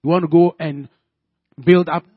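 loud, fervent preaching voice. Acted. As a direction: pray it out loud with full force. Prays aloud at full force.

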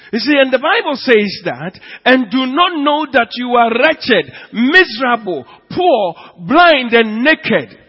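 loud, high preaching of the verse; full-force preaching. Acted. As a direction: hurl each word of the list.

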